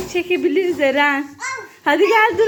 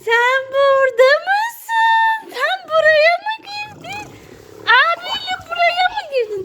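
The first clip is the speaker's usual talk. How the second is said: put-on surprised baby talk; 9 semitones higher than usual. Talking to toys in a box.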